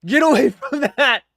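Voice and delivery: hammed-up dramatic shout, laughing; mock movie line. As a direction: parrot the line, mocking it. Echoing the trailer line mockingly.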